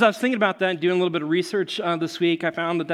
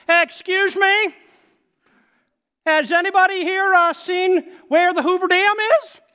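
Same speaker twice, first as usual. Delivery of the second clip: high, loud, goofy mock-innocent tourist voice; obnoxious voice; 13 semitones higher, 7 dB louder than usual. Friend leaning out of the car to strangers, obnoxious high voice. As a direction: ham up a clueless, obnoxious tourist shouting from a car. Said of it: "obnoxious voice".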